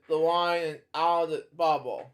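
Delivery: usual speaking voice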